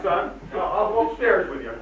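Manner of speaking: ordinary talk, nothing marked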